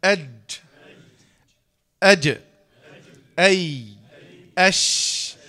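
"أج", "أي", "أش" punched out one by one; loud separate letter sounds "أج", "أي", "أش" with a long sh; mid-tongue letters demo. Shown as a demonstration. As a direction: isolate each of "أج", "أي", "أش" firmly. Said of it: "حروف كلمة جيش من وسط اللسان".

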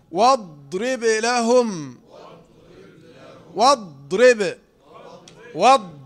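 loud, very slow "واضرب لهم" with ض held; deliberate tajweed recitation of "واضرب لهم"; dad in recitation. Shown as a demonstration. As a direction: press and hold the ض in "واضرب" and "واض". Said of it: "حرف الضاد".

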